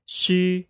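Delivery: Shu held high and level with rounded lips, Mandarin xu; pinyin xu first tone. Shown as a demonstration. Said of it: "Repeat after me".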